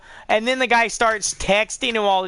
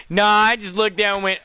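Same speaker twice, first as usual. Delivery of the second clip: ordinary talk, nothing marked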